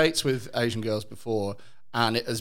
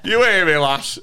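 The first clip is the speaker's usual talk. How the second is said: put-on Lancashire accent; 5 semitones higher, 7 dB louder than usual. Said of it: "my bloody Lancashire accent".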